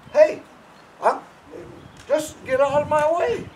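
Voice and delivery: high, loud "hey", shoving a horse off; pushy handler yelling at a horse; loud bossy shout. Shown as a demonstration. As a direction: bark it like you own the feed area. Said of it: "Act like a stupid".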